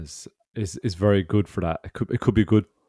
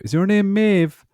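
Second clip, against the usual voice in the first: high clerk voice; 13 semitones higher, 4 dB louder, slower than usual. Retold or imitated. Clerk calling name, voiced.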